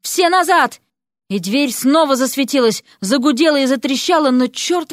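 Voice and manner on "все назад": high, urgent command; high-pitched warning cry; explosive shout. A narrator's reading. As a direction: burst out with an order. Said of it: "взорвалась криком".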